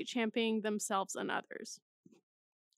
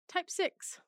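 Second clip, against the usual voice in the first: ordinary talk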